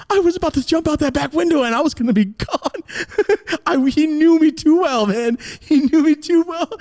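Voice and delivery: very high, loud exasperated telling; animated, high-pitched comic frustration; worked-up storytelling. Retold or imitated. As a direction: pitch it way up, half laughing. Animated retelling of foiled escape.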